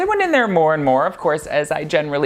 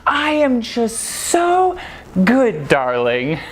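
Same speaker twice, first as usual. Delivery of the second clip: put-on higher, slow, drawn-out doting old lady; imitating Eve; 5 semitones higher, much slower than usual. Eve answering her regular customer, slow and sweetly drawn out. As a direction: stretch it out like a doting shopkeeper. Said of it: "She'd be like".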